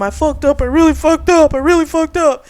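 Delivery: high, rising panic; panicked confession. Retold or imitated. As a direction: blurt it out in a panic. Keegan panicking to Eric.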